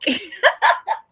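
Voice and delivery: loud, high-pitched laugh; high burst of laughter. Real, not acted. Caller laughs after loudest mic jab.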